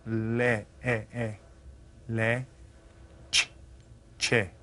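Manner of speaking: Le, then e, e held softly, then che built up; vowels pulled apart. Shown as a demonstration. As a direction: isolate each vowel sound for the class. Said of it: "do you hear the vowel sounds?".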